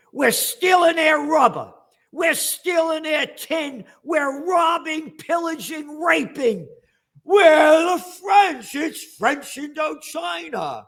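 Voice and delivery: shouted colonizer caricature, slow and high; mock French colonizer. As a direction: bellow it like a proud plunderer boasting.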